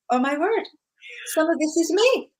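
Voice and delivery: reading a war novel, she suddenly sees herself in it, lightly surprised; retold realization. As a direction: relive the moment it suddenly hit her.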